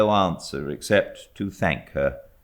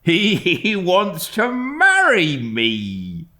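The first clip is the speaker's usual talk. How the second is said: put-on slow, louder, exasperated old servant; servant Peggotty; 13 semitones higher, 5 dB louder, much slower than usual. A narrator's reading. Servant fussing about her suitor, slow exasperated huff. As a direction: huff it out like a flustered nurse.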